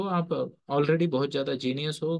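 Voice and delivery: ordinary talk, nothing marked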